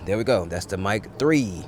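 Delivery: normal talking voice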